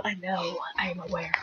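hushed, sheepish whisper; barely voiced, under the breath. Real, not acted. Embarrassed, caught out by friends.

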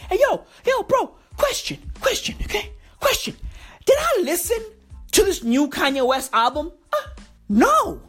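high squeaky rant. Acted. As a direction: squeal it out like a hype bro.

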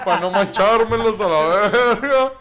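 muy fuerte y lento, entre risas; carcajada. Real, not acted. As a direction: grita la frase riéndose a carcajadas. (imitando a la señora entre carcajadas)

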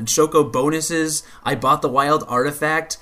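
ordinary delivery

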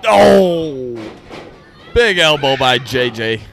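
wrestling commentator. Acted. Mock wrestling play-by-play call.

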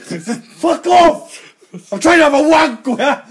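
high, loud comic outburst; mock angry yell. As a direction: yell it at pestering birds, half laughing. Acts out yelling at noisy birds.

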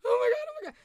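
very high panicked squeal; hammed-up high-pitched freak-out; mock panic. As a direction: squeal it like frightened kids. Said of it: "we're just like".

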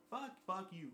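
ordinary talk

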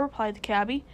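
ordinary delivery, nothing marked

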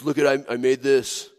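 a hint of a smug man bragging; boastful guy. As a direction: show off a little hole like a wonder. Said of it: "he turns to people and he goes".

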